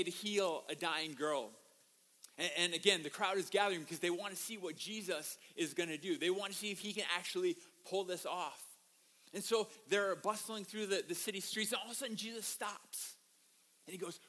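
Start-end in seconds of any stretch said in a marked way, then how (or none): none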